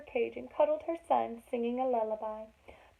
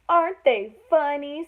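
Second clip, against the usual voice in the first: mocking giggle; 4 semitones higher, 9 dB louder than usual. Elephants giggle at the ears.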